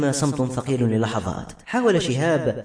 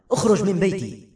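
higher, acted angry order; angry outburst; 6 semitones higher than usual. A narrator's reading. Man shoving his visitor out of the house, angry. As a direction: throw him out with restrained force. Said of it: "ثار شهاب ودفع أنس".

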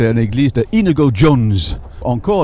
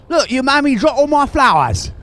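Cockney Eliza, pitched up and pushed out; cockney accent; 11 semitones higher than usual. Retold or imitated. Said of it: "C'est un peu comme ça".

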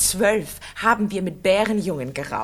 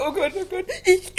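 schluchzend, in höherer Stimme; verzweifeltes Weinen; 8 semitones higher than usual. Acted. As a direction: brich in Tränen aus. Verzweiflung über Kinderlosigkeit. (Oksana weint verzweifelt über ihre Kinderlosigkeit)

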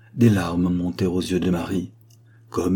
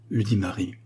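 plain reading voice

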